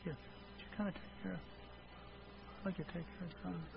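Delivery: barely audible, hesitant asking; faint, trailing half-hearted request; timid mumbled request. Shown as a demonstration. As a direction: ask so softly nobody could catch it. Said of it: "if they asked you like this".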